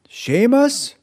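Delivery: a high alarmed call of his name; crying out. Acted. Mother finds the empty cage, calls her son in alarm. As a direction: cry out for him in alarm. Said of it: "she cried".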